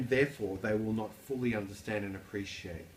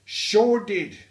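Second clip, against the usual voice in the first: loud, high-pitched Australian; Australian accent; 11 semitones higher, 14 dB louder than usual. Acted. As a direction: belt it out in a broad, hammed-up Aussie accent. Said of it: "the work known as the Australian accent".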